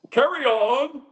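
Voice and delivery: high-pitched mock British accent; put-on British accent. Sensei to the class after demonstrating, mock British accent. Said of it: "British accent".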